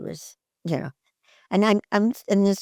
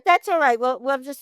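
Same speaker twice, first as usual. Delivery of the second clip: mock impatient director; 6 semitones higher than usual. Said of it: "and he goes".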